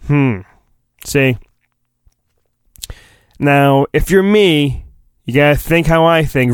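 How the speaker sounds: ordinary talk, nothing marked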